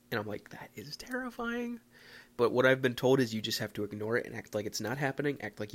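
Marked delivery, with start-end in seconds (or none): 0.5-1.8 high, soft, slow whispered squeak; mock-scared whisper. Walker hearing his name in the woods, mock-scared whisper. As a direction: whisper it slowly in pretend terror. Retold reaction to eerie call.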